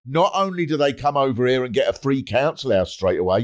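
usual speaking voice

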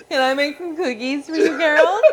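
a light, lilting camp voice; hammed-up sing-song host offering cookies. Teasing friends with a playful camp voice.

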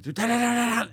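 rapid high rat-a-tat; imitated gunfire. Shooter shows gun working perfectly, imitated gunfire. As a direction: rattle off a burst of fire with the mouth.

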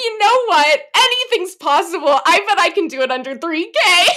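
high, loud, giddy self-quote; mock naive confidence. Bride mocking her first budget guess, giddy overconfidence. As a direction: play up your past overconfidence, on the edge of laughter.

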